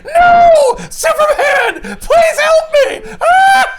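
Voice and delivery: high, loud, slow mock wail for help; hammed-up begging. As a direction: beg like a terrified sidekick. Batman begging Superman.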